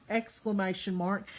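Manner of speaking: normal talking voice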